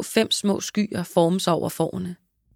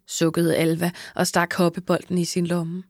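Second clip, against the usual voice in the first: plain reading voice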